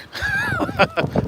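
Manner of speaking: a high, giddy burst of laughter. Real, not acted. Vlogger laughing alone in heavy snow, high giddy laugh. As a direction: laugh with pure delight at the snow.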